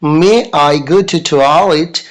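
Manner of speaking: very slow, drawn-out repeat. Shown as a demonstration. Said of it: "आप बोलिएगा".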